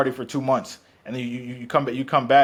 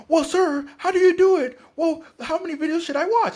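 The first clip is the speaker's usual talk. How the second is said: mock squeaky voice, much higher and louder; naive younger self; 5 dB louder than usual. Host mocking his younger self asking dating-video gurus, squeaky high. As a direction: pitch it way up like a clueless kid asking for tips. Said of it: "I was like".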